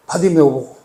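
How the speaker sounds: ordinary talk, nothing marked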